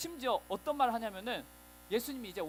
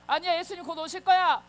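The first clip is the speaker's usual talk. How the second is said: firm insistence; 8 semitones higher, 11 dB louder than usual. Acted. Believer shouting back at the mockers, loud defiance. Believer repeats the answer louder.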